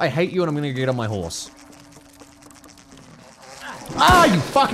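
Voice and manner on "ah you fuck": loud, high startled outburst; startled yell. Real, not acted. Gamer jumped on by a giant mosquito, startled yell. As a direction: yelp it as something jumps at you.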